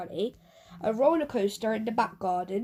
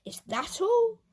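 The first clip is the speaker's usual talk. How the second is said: much higher, spoilt disbelief; scornful disbelief; 11 semitones higher, slower than usual. Acted. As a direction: act insulted by the gift. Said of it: "he scoffed".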